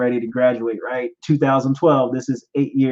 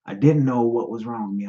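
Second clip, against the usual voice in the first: ordinary talk, nothing marked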